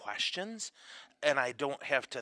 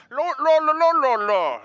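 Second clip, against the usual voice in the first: mock English accent; 14 semitones higher, 13 dB louder than usual. Shown as a demonstration. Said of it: "No, it sounds like this".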